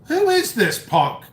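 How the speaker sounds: high, incredulous mock outburst; retold outrage. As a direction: act out the disbelief at the troll. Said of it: "I'm like".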